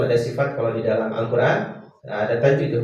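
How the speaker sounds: normal talking voice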